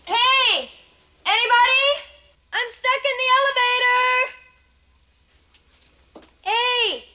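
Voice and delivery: calling for help. Acted. Trapped in elevator, calling out.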